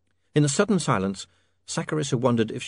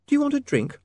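very high lady's voice; kindly young woman. Acted. Sacharissa offers Otto a drink.

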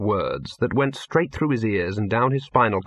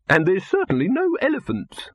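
high-pitched irritated retort; snappish; 8 semitones higher than usual. A narrator's reading. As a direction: snap the objection back. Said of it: "snapped Silverfish".

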